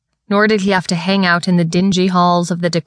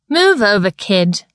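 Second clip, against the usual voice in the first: mocking bully; 3 semitones higher than usual. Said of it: "the bigger boy laughed".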